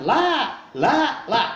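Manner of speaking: a dying man who can't get past "La", repeating it haltingly; dying man stuck. Acted. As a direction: stall on "La, la, la" as if the rest won't come. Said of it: "tidak mengatakan". (orang sekarat tersendat, hanya bisa mengucap "La, la, la")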